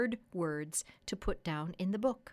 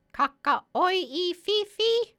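high, loud, slow parrot call; bird language; 11 semitones higher, 10 dB louder, slower than usual. Acted. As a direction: squawk each syllable out slowly in a high parrot voice. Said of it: "in bird language".

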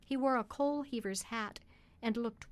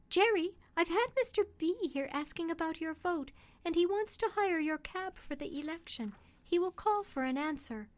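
higher, lightly voiced wife; Polly, the wife; 7 semitones higher than usual. A narrator's reading. Polly telling Jerry about Mister B, in a higher voice. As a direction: bring news gently as the wife.

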